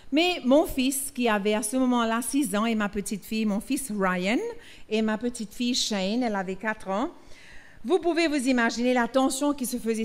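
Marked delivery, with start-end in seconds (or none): none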